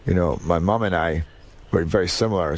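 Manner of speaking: normal talking voice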